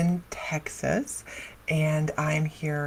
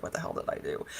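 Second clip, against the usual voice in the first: worried mutter; 6 semitones lower, 11 dB quieter, faster than usual. Retold or imitated. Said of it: "really concerned".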